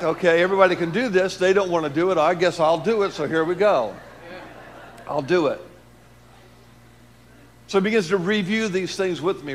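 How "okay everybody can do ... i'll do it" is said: raised, louder voice, half-shouted resolve. Retold or imitated. Preacher loudly voicing his own decision. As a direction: build it up like a rallying call.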